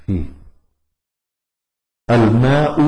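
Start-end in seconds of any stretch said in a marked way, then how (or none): 2.1-2.8 الماء with a final "u", tune rising; الماء ending on a rising damma; nominative ending. Shown as a demonstration. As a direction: recite الماء as al-maa'u, damma sounded clearly. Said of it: "تغير الحرف الأخير فيه من الرفع".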